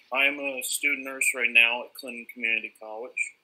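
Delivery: usual speaking voice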